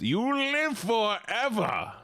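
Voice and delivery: slow, high, otherworldly god voice; booming god voice. Pretend. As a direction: speak as God, slow and grand. God's voice in a joke.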